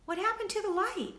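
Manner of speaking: high, loud scared question. A narrator's reading. Lucy in the dark fridge, high and scared. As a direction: panic at the sudden dark. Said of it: "Lucy cried".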